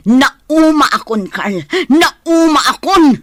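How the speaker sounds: fed up, rising to a loud cry; sick of him, voice climbing; exasperated. Acted. Fed-up wife confronting Carl.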